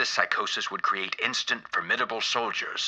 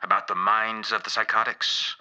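normal talking voice

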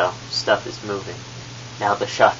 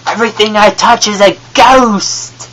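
put-on loud, high exclamation in a British accent; 10 semitones higher, 10 dB louder than usual. A ghost announcing its powers in a British accent. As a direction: declare it like a Brit showing off. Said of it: "talking in a British accent this whole time".

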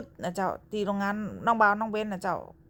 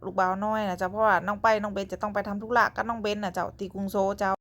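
ordinary talk, nothing marked

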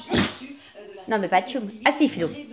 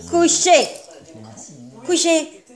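ordinary talk, nothing marked

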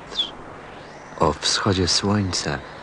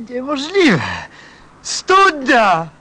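much higher and louder, thrilled; overjoyed cry at finding the well; excited discovery; 5 dB louder, slower than usual. Acted. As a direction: cry out in amazed joy.

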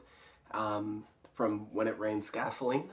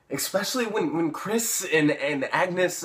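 loud, higher, stumbling over the names; agitated; 6 semitones higher, 7 dB louder, slower than usual. Acted. Paul bringing up Chris and Agnes, agitated and stumbling. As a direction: get worked up and falter. Acted, upset naming his tormentors.

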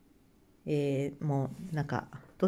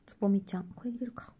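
ordinary talk, nothing marked

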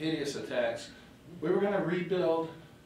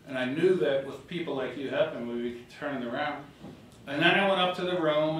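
usual speaking voice

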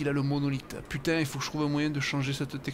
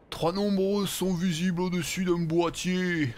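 high, slow, drawn-out caricature; Bernard Laporte; 4 semitones higher, 3 dB louder, slower than usual. Acted. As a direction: read it out as a sing-song parody, slowly. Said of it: "façon Bernard Laporte".

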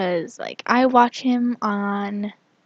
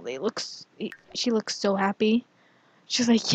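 ordinary talk, nothing marked

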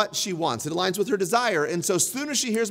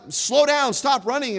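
higher, louder yell at his daughter; retold yell; 6 semitones higher, 5 dB louder than usual. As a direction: shout it like a scared dad. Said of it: "I would yell".

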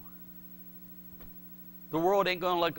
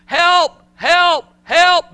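high, loud cries of help; much higher, much louder pleading shout; crying out for help; 11 semitones higher, 12 dB louder, much slower than usual. Acted. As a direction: cry out like desperate slaves. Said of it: "they're crying out".

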